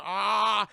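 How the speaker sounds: loud, high drawn-out "ah"; mock yell. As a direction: yell out as if attacked. Acts out hero being attacked.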